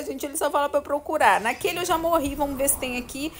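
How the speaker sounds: ordinary delivery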